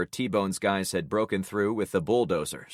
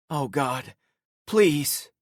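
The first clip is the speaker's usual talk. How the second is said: high, rising desperate plea; pleading; 6 semitones higher, 4 dB louder, much slower than usual. A narrator's reading. Narrator rushing to the girl, desperate plea. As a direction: beg as you rush over. Finds dead friend's body.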